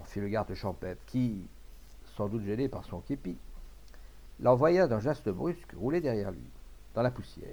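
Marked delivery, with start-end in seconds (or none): none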